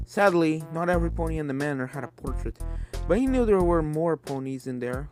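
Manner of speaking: usual speaking voice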